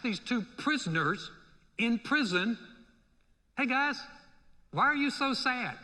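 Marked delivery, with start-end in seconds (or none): 3.6-5.8 higher, lightly acted cheerful question; high-pitched innocent query; chirpy naive greeting. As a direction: ask it as an oblivious, upbeat visitor. Voices Joseph greeting sad prisoners.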